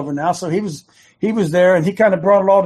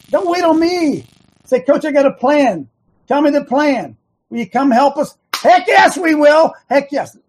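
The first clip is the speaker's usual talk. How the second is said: higher and loud, building to heck yes; fired-up urging; 7 semitones higher, 4 dB louder than usual. Acted. Host urging listeners to call the coach, shouting. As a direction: pump them up to act. Urging, acting eager ally's plea.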